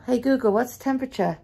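usual speaking voice

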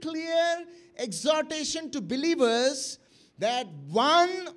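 insistent, building exhortation; emphatic preaching. Real, not acted. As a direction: preach it high and strong, stretching key words.

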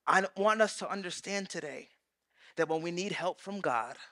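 normal talking voice

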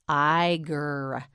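"Tiger" with t apart, then long iger; sounded out. Shown as a demonstration. Teacher models the animal word, "Tiger" sounded out slowly. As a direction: break "Tiger" after the t and draw out the rest. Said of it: "You say it".

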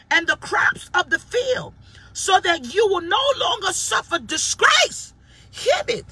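high, loud, slow peak of the sermon; rising preaching shout. Acted. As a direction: build to a full preaching peak. Preacher builds to climax.

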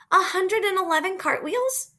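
high, incredulous question; amazed. Acted. Child questions a friend's cartwheel record, amazed. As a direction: marvel at the number, pitch way up.

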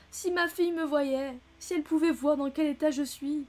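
a mild hammed-up weep; mock sobbing complaint; tearful lament. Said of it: "elle se mit à pleurer".

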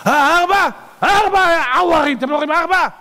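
high, loud and incredulous; astonished outburst. Acted. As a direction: cry out at people who cannot see it. Acted king insisting he sees four.

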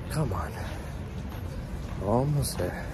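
ordinary talk, nothing marked

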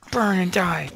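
loud taunting yell; shout. Real, not acted. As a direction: yell it at the burning mobs. Yelling at burning mobs.

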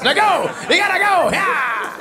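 put-on high excited kid shouting go; hyped kid. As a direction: launch your sister into mischief. Son sending sister off.

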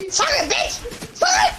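laughing, then a high yelled sorry; laughing mock sorry. Real, not acted. To teammates after ditching them, laughing shout. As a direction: cackle out a fake apology.

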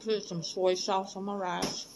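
talking between bites; muffled chewing speech; mouth full, chewing. Real, not acted. Seen 2: Bite at -2.0; mouth still working food while speaking.

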